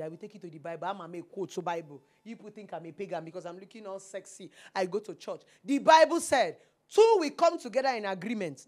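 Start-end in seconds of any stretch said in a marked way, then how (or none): 5.6-8.6 preachy shout. Acted. Preaching scripture at listeners.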